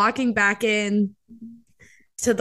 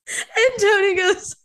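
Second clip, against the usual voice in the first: teary; 10 semitones higher than usual. Real, not acted. Tearful while retelling sweet moment.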